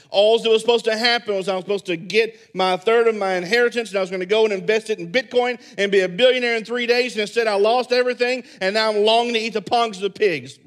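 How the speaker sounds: loud, high, fast ranting; hammed-up angry rant of the prodigal son; mad rant. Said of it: "could have got really mad and said".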